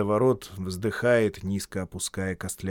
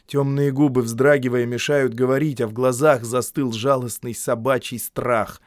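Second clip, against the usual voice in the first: plain reading voice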